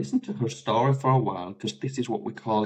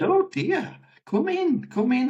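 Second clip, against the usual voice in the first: high, sing-song "oh dear, come in"; retold gentle, much higher-pitched welcome; soothing welcome; 14 semitones higher than usual. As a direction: coo to calm someone who is crying. Comforting a client who bursts into tears.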